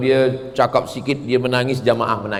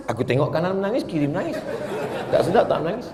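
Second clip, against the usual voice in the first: sheepish laughing; 3 semitones higher, 5 dB quieter, faster than usual. Retold or imitated. Worshipper confesses, laughing.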